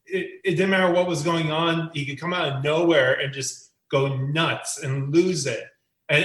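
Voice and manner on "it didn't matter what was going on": ordinary talk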